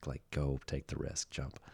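soft, hushed urging to jump; whispering. Retold or imitated. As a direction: whisper it like an inner voice. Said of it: "is whispering".

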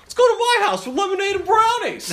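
high, loud cheery tough guy; jolly old-time cop; 1930s beat cop. Retold or imitated. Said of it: "Scott's is playful sometimes".

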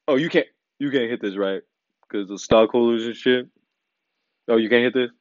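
a lightly voiced sly dig at the rich man; mock-sly Joe Rogan egging on; sly tease. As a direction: coax him slyly, teasing. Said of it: "he pulls a little sly when he's like".

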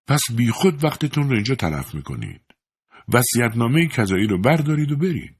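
a hint of a pompous high priest; haughty man. A narrator's reading. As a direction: brush them off like a proud preacher. Calhoun dismissing the priest.

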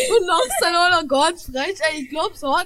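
loud, slow indignant yell, laughing and whimpering; slow it down and shout it while half laughing. Acted. Quarrel in the grocery line.

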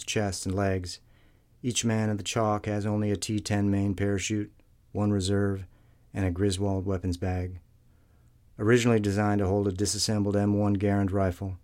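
plain reading voice

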